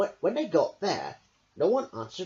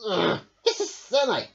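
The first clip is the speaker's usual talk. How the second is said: grumbling; 6 semitones higher, 6 dB louder than usual. Acted. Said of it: "grumbled Fox".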